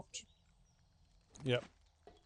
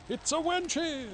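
higher, slower grand announcement; hammed-up announcement; 12 semitones higher, 4 dB louder, slower than usual. Streamer introducing the wenches, grand mock announcement. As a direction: announce it like a game-show reveal.

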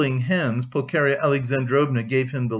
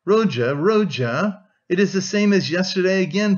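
mournful cry; 5 semitones higher, 4 dB louder than usual. A narrator's reading. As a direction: plead with her son, grieving. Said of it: "cried mournfully".

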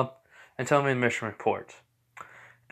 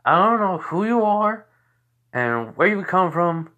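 higher, louder, demanding who he is; suspicious challenge; 8 semitones higher, 5 dB louder than usual. Acted. Girl distrusting the newcomer, loud suspicious challenge. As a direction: confront the stranger sharply.

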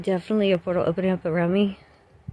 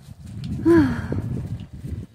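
long breathy sigh; 4 semitones higher than usual. Real, not acted. Owner worried about her dog, long sigh. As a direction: let out a long exhale.

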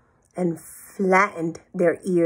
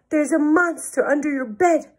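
high, slightly louder warning cry; storybook alarm, pitched up; 9 semitones higher, 4 dB louder than usual. A narrator's reading. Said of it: "cried Pig".

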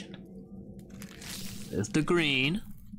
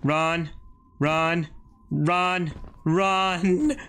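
higher, louder urgent running calls; being chased; 4 semitones higher, 3 dB louder, much slower than usual. Real, not acted. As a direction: urge yourself on while fleeing. Fleeing enemies in game.

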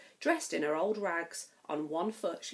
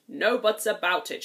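a louder, blustering king; shouting king; 7 dB louder than usual. Acted. King shouts down the Grand Duke, louder. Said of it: "shouted the king".

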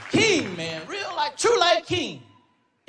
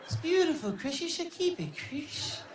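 high soft Michael, dreamy; 4 semitones higher, 6 dB quieter, slower than usual. Retold or imitated. Impersonating Michael charming Chris about his name, high and soft. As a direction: drift off sweetly like Michael, slow and airy.

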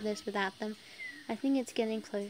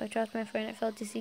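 usual speaking voice

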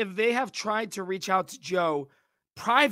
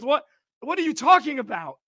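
ordinary delivery

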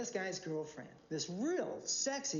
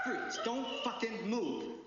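higher, slow and deliberate order; firm storytelling imitation of an armed cop; acted police command; 4 semitones higher, much slower than usual. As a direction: retell it as a tense, measured warning. Officer's command acted in his story.

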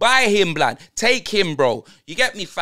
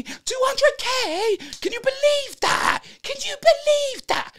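high, slow, squealing mock outrage, ending in a shout; shrieking disbelief; slower than usual. Fan mocking a player's wage in a shrieking high voice. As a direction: shriek it out like you can't believe the wage. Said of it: "Man said".

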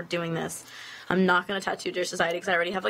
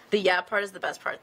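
ordinary talk

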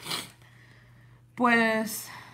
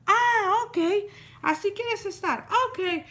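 much higher, snotty offended tone; mocking neighbor; 10 semitones higher, slower than usual. Retelling the neighbor's huffy reply when refused, much higher. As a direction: mimic the neighbor's huffy sarcasm.

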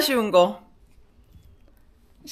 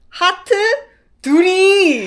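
laughing reveal; 12 semitones higher, 7 dB louder, much slower than usual. Real, not acted.

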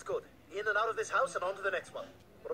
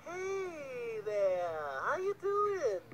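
awkward coaxing; much slower than usual. Acted. Intruder caught by a small child, awkwardly coaxing. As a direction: stretch it out, falsely casual.

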